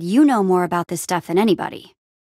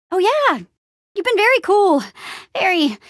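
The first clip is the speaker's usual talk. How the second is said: much higher, gushing reassurance; over-eager praise; 10 semitones higher than usual. Acted. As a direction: lay on the praise in a high voice. Gushing that someone was cool.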